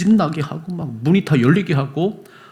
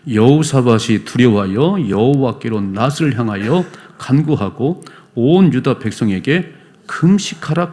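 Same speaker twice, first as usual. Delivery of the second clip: slow, loud unison Bible reading; congregational scripture reading; 5 dB louder, slower than usual. Real, not acted. Pastor leading church in reading Chronicles, slow and loud. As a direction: read it together, measured and slow. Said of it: "읽어봐요".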